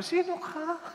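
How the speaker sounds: puzzled priest. Acted. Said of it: "Si Father".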